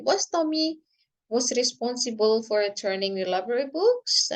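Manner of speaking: yes-no question climbing up on "books?"; rising question intonation. Shown as a demonstration. Teacher models a yes-no question for students, rising on "books?". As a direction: lift the pitch at the end on "books?". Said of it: "Itu harus naik".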